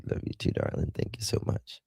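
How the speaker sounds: soft whispered thank you. Real, not acted. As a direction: breathe a tender thanks to the chat. Softly thanking viewers.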